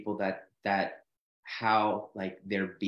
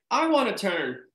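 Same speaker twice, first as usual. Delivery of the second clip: eager whine; 10 semitones higher, 5 dB louder than usual. Retold or imitated. Dane wanting his turn.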